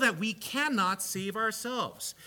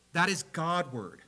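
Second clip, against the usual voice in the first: normal talking voice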